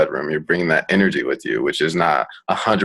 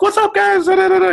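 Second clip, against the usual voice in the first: mock squeaky, very high dude voice; high-pitched voice; 5 dB louder than usual. Mimicking a dude approaching a woman, high-pitched voice. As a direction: imitate a guy with a ridiculously high pitch. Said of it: "like, a high pitched voice".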